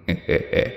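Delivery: breathy rasping ghost laugh; hoarse whispered cackle. Acted. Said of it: "Một giọng nói thì thầm bên tai, khàn đục như người ho lao".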